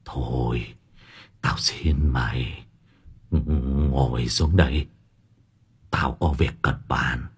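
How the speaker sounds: put-on low, soft, worn-out old man; weary pleading. A narrator's reading. Master begs his servant to sit and talk, wearily. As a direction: plead quietly with little strength left.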